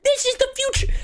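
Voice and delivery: high-pitched mocking whine; mock the complainers in a high, silly voice; whiny complainer. Streamer mimicking Switch two complainers, whiny mocking voice. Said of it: "all these motherfuckers that have complained".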